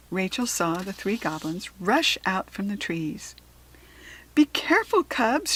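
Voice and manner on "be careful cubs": higher and louder warning call; urgent yell. A narrator's reading. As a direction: shout to warn the cubs. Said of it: "she yelled".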